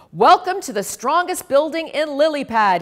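loud, booming welcome; bellowing mayor. A narrator's reading. Mayor greeting families, loud booming welcome. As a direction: bellow it to the crowd. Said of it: "he bellowed".

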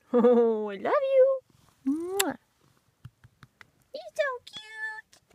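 high, loud cooing pet voice; baby talk to pets. Acted. Owner greeting her rabbits in a high cooing voice. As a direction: coo up high at the bunnies.

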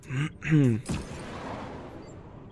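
throat clearing. Real, not acted. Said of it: "irgendwas im Hals".

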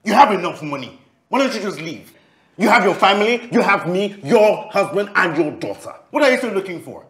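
high, insistent, heated demands; angry confrontation. Acted. Man demanding his lover leave, angry confrontation.

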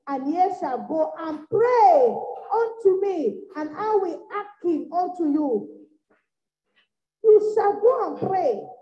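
preacher intoning the verse; solemn scripture reading. Real, not acted. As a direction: intone it like a proclamation. Scripture read then repeated.

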